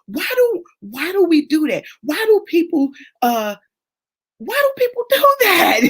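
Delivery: high, incredulous questions ending in a laugh; exasperated disbelief. Retold or imitated. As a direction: throw up your hands at people. Exasperated retold question, laughing end.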